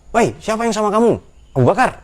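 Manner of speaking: high, loud call of the pursuing men. Acted. Pursuers hail Abu Bakar in the dark, calling out loud and high. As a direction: shout across the dark to the travellers.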